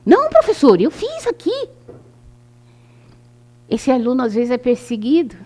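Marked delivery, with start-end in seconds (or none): 0.1-1.7 high, pleading pupil voice; child protesting. Retold or imitated. Pupil protesting to the teacher, high and pleading. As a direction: play a kid insisting he did it. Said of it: "o professor falou assim".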